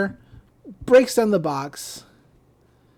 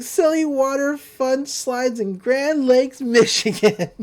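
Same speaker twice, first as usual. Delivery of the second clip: giddy cops; 5 semitones higher, 4 dB louder, slower than usual. Retold or imitated. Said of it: "they're like".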